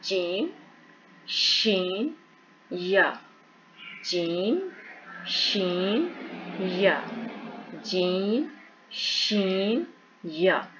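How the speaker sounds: जीम, शीन, या said one by one, carefully; letter drill. Shown as a demonstration. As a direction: recite each letter clearly. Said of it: "तो ये तीनों हरफ अदा होते हैं".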